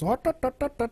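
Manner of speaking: bad Indian accent. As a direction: imitate a friend's failed Indian accent. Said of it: "I could do an Indian accent".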